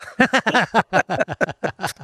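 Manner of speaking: light amused chuckle; real laugh. Real, not acted. Joking that only some stories are true, with a real laugh. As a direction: laugh at his own joke.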